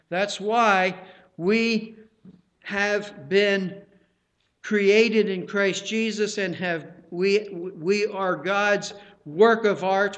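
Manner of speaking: normal talking voice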